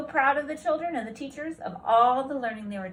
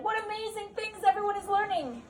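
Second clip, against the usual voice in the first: high, beaming principal; 10 semitones higher than usual. Acted. Principal character voice.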